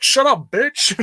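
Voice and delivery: hammed-up heel telling her to shut up; mock rude brush-off. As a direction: bark it like the rude wrestler, half in jest. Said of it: "He's like".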